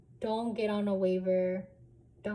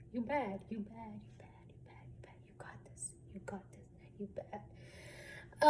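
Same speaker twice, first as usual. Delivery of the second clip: softly whispered you bet, you got this; hushed self-encouragement; whispered pep talk; 9 dB quieter than usual. Pretend. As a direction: breathe it to yourself like a private pep talk. Self pep talk muttered to herself.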